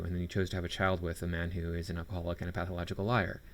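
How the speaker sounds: ordinary delivery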